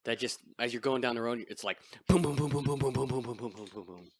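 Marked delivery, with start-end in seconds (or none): none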